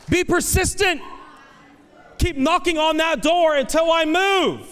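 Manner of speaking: high, loud, driving exhortation; full-force preaching. Acted.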